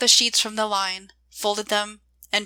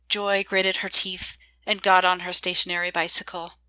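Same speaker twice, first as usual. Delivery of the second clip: ordinary delivery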